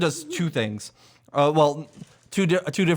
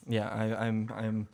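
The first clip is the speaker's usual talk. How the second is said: ordinary talk, nothing marked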